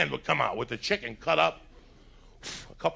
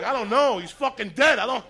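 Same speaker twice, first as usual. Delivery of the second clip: doubtful customer; 8 semitones higher, 4 dB louder than usual. Retold or imitated. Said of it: "You're like".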